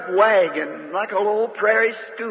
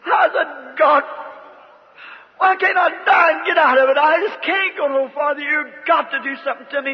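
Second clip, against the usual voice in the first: crying out to God in despair; 6 semitones higher than usual. Retold or imitated. Preacher retelling his despairing prayer to God, anguished cry.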